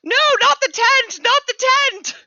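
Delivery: squeaky creature panicking; panicked high cry. Acted. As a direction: shriek it like the tent is doomed. Anton cries out in panic.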